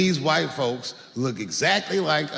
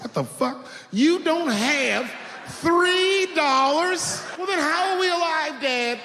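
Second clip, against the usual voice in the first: very high, slow, disbelieving whine; stunned outrage; 11 semitones higher, slower than usual. Retold or imitated. Twelve-year-old shocked his dad lacks three dollars, high slow whine. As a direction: stretch it out in outrage.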